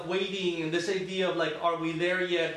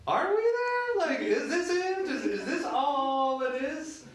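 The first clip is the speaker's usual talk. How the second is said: mom asking; 10 semitones higher, slower than usual. Retold or imitated. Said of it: "she's like".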